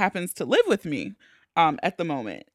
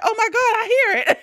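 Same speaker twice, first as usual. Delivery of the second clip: high, loud mock alarm; acted high-pitched fright; startled mom; 13 semitones higher, 5 dB louder than usual. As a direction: gasp it out as mom, laughing a little.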